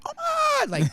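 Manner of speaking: high squeal of delight, imitating a friend; mock gush as he greets you; gushing Oh my God. Friend's warm greeting mimicked with a high excited squeal. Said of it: "he's always like".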